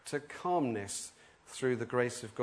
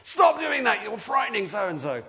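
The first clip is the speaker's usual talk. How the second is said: loud, high parental telling-off; scolding shout; 8 semitones higher, 5 dB louder than usual. Retold or imitated. Parent shouting at children splashing on the lake, loud scolding. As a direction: bark it at naughty kids. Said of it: "like you would shout at your children".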